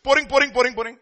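high, insistent mimicked Indian English accent; lab instructor's accent. Lab instructor scolds a student at the burette, imitated accent. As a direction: imitate the instructor's accented, rapid-fire "pouring". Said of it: "he's in his own English".

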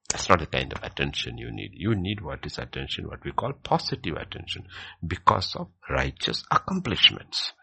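ordinary talk, nothing marked

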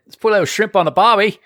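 put-on a cheerful, overdone Australian accent. Mixing up countries in a jokey Australian accent. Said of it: "That's two countries".

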